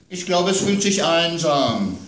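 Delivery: put-on child's voice worried about monsters at bedtime; little girl. A narrator's reading. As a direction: play the small daughter scared of monsters.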